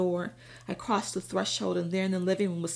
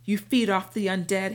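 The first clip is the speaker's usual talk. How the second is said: loud, furious spitting of the words; a loud seething accusation; 7 dB louder than usual. Acted. Cornered vampire hurls loud seething insult at narrator. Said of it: "he seethed".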